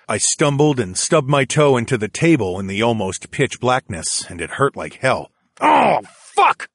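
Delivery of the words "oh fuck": high, loud curse; pained loud exclamation. A narrator's reading. Man stubbing his toe in the dark, loud yelp. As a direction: burst out cursing in pain. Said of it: "louder than I meant".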